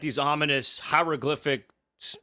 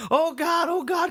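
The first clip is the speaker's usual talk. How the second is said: high panicky oh God; hammed-up panic as she rolls down the hole; mock panicked cry; 13 semitones higher than usual. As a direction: imitate her tumbling down scared. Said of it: "she's saying".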